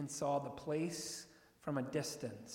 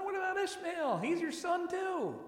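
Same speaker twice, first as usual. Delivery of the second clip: much higher, mock-bewildered protest; puzzled objection; 12 semitones higher than usual. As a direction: object as if baffled.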